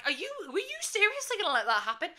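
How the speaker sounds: high, rushed mock outrage; indignant. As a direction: fire it off fast in disbelief. Retelling confronting useless bouncers.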